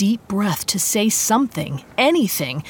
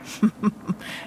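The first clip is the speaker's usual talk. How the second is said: breathy evil chuckle under his breath; sinister chuckle; 6 dB quieter than usual. Acted. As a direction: laugh softly like a predator. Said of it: "as he chuckled".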